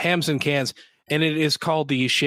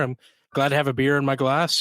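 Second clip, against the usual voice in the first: ordinary talk, nothing marked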